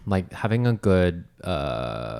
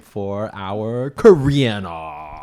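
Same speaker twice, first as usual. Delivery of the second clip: slow, loud announcer build; segment announcer; 3 semitones higher, 5 dB louder, much slower than usual. Pretend. Co-host opening the Korean segment, announcer voice. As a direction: draw out the segment introduction.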